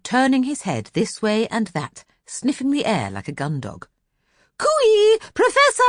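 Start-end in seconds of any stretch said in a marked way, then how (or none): 4.6-5.8 much higher, loud calling across the lawn. Acted. Miss Lark hurrying across the lawn, calling high and loud. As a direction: hail him from far off, high and loud. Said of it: "called Miss Lark".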